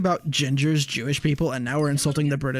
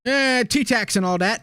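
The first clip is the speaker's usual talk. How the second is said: a hammed-up British accent, slow and drawn out; 7 semitones higher, slower than usual. Friend mocking Brits to his co-streamers, in a hammed-up British accent. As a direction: ham up a British accent, like a tea-party cartoon. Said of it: "The British are infecting me".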